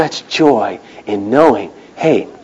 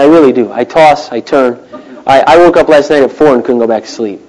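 usual speaking voice

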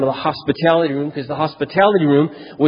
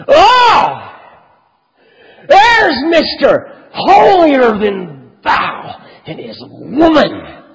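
loud, much higher, slow sneering call across the room; mocking jeer; 11 semitones higher, 7 dB louder, much slower than usual. Rival salesman Wally mocking the preacher and his wife, loud sneer. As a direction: mimic the rival sneering for everyone to hear.

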